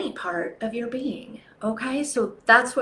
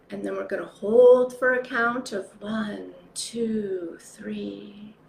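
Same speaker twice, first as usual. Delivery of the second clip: guided meditation; 3 dB quieter than usual. Acted.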